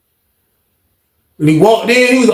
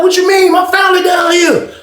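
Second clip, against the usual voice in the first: indignant protest; 11 semitones higher than usual. Retold or imitated.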